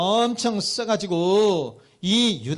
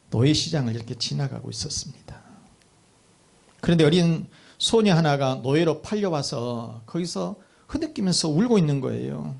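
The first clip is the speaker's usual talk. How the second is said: ordinary talk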